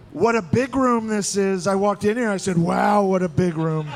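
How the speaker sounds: high, slow describe-it-twice cadence; Donald Trump. Shown as a demonstration. Comic showing how to do the candidate's cadence, high slow impression. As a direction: raise the pitch and stretch the phrases. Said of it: "it's not hard to do Trump".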